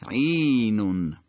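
عینون slowly, "ʿīīī-nun"; elongated vowel. Shown as a demonstration. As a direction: hold the long ī in عینون, then fall. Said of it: "حرکت کشیده هست".

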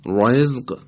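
slow رزق with a thick r, like "roezq"; wrongly heavy ra. Shown as a demonstration. Tajweed teacher showing a mistake, heavy ra in رزق. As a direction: darken the r and vowel of رزق.

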